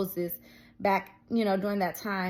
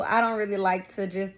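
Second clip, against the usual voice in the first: ordinary talk, nothing marked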